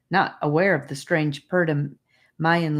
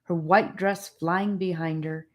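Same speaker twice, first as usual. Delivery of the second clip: usual speaking voice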